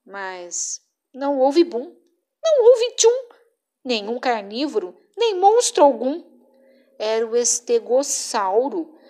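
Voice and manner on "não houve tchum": higher and louder on não; high bright tchum. A narrator's reading. Narrator teasing listeners, high and louder. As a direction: pop the line up high. Refrain sound effect.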